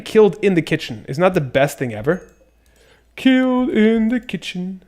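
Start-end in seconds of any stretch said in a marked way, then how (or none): 3.1-4.8 high slow singsong echo; delighted singsong; gleeful singsong. Acted. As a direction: savour each word, up high and slow. Gleeful echo of own phrase.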